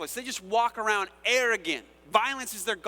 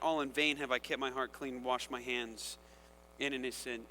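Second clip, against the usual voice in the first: softer, low dejected lament; depressing statement; 5 semitones lower, 5 dB quieter than usual. Acted. The psalmist confessing his despair to God, quiet and sad. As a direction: let it sink, defeated. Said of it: "his depressing statement".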